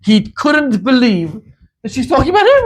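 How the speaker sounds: normal talking voice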